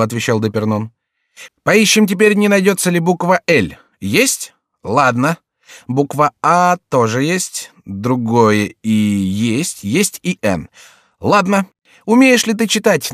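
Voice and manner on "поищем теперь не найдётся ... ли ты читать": lively fool ticking off finds, often pitched up; lightly voiced jester. A narrator's reading. Jester hunting letters in the signature, lively and insistent. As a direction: lightly play an eager jester giving dictation.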